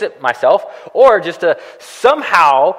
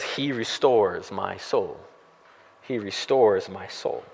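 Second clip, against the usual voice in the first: much softer, slow, low recitation; reverent quoting; 9 semitones lower, 9 dB quieter, slower than usual. Shown as a demonstration. Pastor reading David's verse to the congregation, soft and slow. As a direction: let the verse settle gently, slowly.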